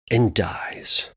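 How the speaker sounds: near-whisper, dropping away; hushed grim ending. Acted. Storyteller revealing the dog's death, darkly hushed. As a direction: let the last words expire softly. Mock-grim end of the dog.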